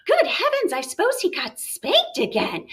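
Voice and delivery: high gasping good heavens, then falling; appalled mother's exclamation; shocked mom. Acted. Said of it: "What does that tell you about the mom".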